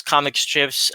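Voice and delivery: ordinary delivery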